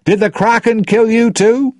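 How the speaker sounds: loud, very high shouted question; high, ringing call; crying out. A narrator's reading. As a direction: call it out high to the whole crew. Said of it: "he cried".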